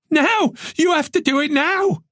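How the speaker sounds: high insistent cry; urgent demand. Acted. Tess ordering Moran to protect her parents, high urgent cry. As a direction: demand it this instant.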